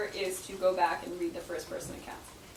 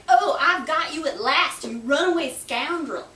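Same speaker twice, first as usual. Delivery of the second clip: put-on louder, higher Southern accent gotcha; Southern accent, triumphant mock shout; 5 semitones higher, 8 dB louder than usual. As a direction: bark it in a Southern accent like grabbing a collar. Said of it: "taking him roughly by the collar".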